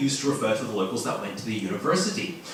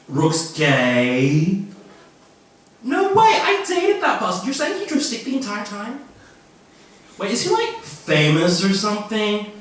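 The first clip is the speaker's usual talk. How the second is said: loud, much higher squeals of disbelief; shocked gossip; 7 semitones higher, 6 dB louder, slower than usual. Acted. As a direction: react like a scandalised student. Students gossip in character.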